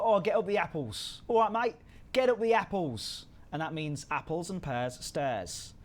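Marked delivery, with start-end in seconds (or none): 0.0-3.2 mock Cockney, louder and higher, chirpy; playing a Cockney telling a mate to go upstairs; Cockney accent. A Londoner calling a mate up the stairs, in a hammed-up Cockney accent. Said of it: "So they go".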